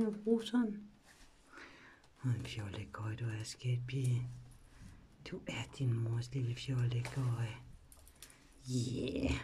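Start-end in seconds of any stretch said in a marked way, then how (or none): 2.2-9.3 low, soft whispered endearments; tender whisper. Real, not acted. Mother whispering pet names to her silly baby girl.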